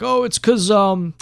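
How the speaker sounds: high mocking whine; mocking excuse. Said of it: "And they're like".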